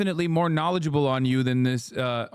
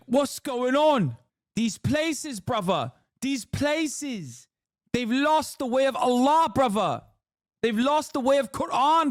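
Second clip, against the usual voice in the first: put-on mad London Salafist accent; 8 semitones higher, slower than usual. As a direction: rant like an outraged London fundamentalist podcaster. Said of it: "It's like Salafists in London getting mad at".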